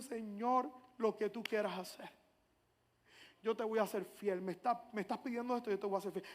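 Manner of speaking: soft, low prayer; quiet surrender. Retold or imitated. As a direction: give in humbly, voice dropped. Retold surrender to God. (oración baja de entrega humilde)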